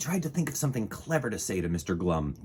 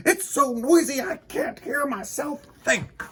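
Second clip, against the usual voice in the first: high, fed-up complaint; frustrated Mister Winky; 3 dB louder than usual. Acted. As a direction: whine about the noise. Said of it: "he said".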